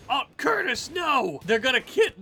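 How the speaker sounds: high, loud despairing yell; anguished shout. Real, not acted. Streamer yelling at the game as Curtis falls, anguished. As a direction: cry out no in real dismay. Colonist shot again.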